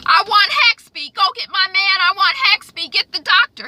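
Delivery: high, loud whiny demand for his man; hammed-up high pleading for the doctor; mock dying nobleman demanding. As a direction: squeal it like a spoiled old lord. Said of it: "Sir George is like".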